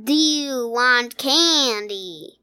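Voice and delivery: slow, drawn-out southern-ish drawl; lazily drawled monster voice; Candyman. Shown as a demonstration. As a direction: stretch every word into a creepy candy offer. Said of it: "an impression of this voice".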